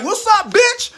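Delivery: high, loud squawked taunt; mock yelled challenge. Mullen shouting a dare at the Teamster, mock yell. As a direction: bark it like a dare. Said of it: "Mullen was like".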